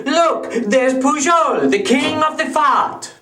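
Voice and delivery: high-pitched cry of an admiring crowd; excited, high acted exclamation; admiring public. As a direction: announce a celebrity sighting with mock grandeur. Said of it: "people would say".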